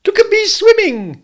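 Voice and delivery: very high, loud squeak; squeaking Roo. A narrator's reading. Little Roo calling out from the pool, squeaking. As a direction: squeak it like a tiny excited kangaroo. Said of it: "squeaked Roo".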